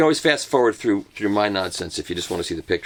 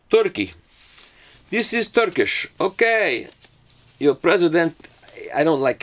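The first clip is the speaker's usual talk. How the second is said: high, hammed-up Turkish accent; mock Turkish accent; 7 semitones higher than usual. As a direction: announce it in an exaggerated put-on Turkish accent, pitched up.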